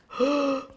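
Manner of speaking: sharp mock intake of breath; a quick imitated gasp of shock; retold gasp. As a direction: gasp as if stunned. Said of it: "and she was like".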